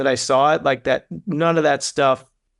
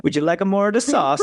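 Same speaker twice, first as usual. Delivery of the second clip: put-on a light Italian host accent offering more sauce; a hint of an Italian accent; 6 semitones higher than usual. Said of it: "That was the best Italian accent ever".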